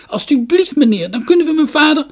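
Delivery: put-on high, loud, begging child; pleading. A narrator's reading. Girl pleads with the merchant, high and urgent. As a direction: plead with everything you have.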